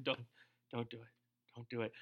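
very soft, low warning; little voice of conscience. Retold or imitated. Conscience softly warning the cake eater, very hushed. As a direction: murmur it like a nagging conscience. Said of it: "that little voice is going".

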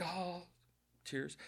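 soft little movie-watcher's sigh; small, hushed show of tears; mock teary. Viewer watching motorcycles blow up at the end, softly teary.

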